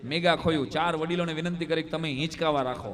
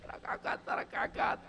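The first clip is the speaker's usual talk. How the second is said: mock wailing; 8 dB quieter than usual. Widow wailing for her dead husband to the boy, hushed mock lament. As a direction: stretch the kaka into a hushed lament. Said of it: "રોતા રોતા કીધા".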